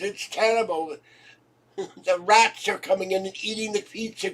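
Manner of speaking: high, loud pleading British official; hammed-up posh British plea; mock royal spokesman. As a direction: plead like a swamped palace spokesman. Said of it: "ten Downing Street".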